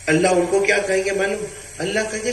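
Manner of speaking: ordinary delivery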